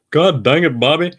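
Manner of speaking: high, loud cartoon dad yelling at his son; Hank Hill impression. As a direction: echo the soundboard's exasperated dad. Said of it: "repeat it back".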